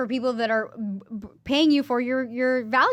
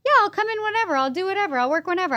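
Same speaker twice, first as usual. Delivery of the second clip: high, sing-out people-pleaser voice; mock eagerness; 8 semitones higher, faster than usual. Stylist agreeing to any client request, mock eager. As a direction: exaggerate an over-obliging yes, pitched high.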